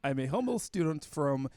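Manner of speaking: slow, put-on Russian accent. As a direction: play the humble student with a mock Russian accent. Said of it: "try to read your stereotypical Russian accent".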